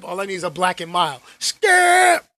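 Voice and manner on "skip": loud high call; shouting Skip. Pretend. Shannon Sharpe bit calling his co-host, shouting. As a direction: holler it across the studio.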